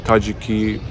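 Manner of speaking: normal talking voice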